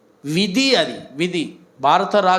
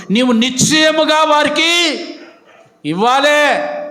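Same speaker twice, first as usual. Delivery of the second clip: higher and louder, slow and commanding; said firmly; 9 semitones higher, 3 dB louder, slower than usual. Shown as a demonstration. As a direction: hammer వారికి home as an order. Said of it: "గట్టిగా చెప్తామా". (గట్టిగా ఆజ్ఞగా)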